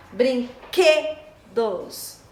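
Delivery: "Brinquedos" very slow, stretched on QUE; syllable by syllable "Brinquedos", strong QUE; stressed syllable. Shown as a demonstration. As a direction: hit the middle syllable of "Brinquedos". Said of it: "tem mais intensidade".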